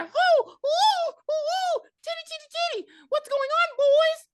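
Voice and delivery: very high, loud whooping arrival; shrieky mock-panic falsetto; hammed-up frantic mom. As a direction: squeal and whoop like a cartoon mother rushing in. Acts mom's frantic whooping arrival.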